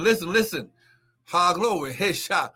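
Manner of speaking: ordinary talk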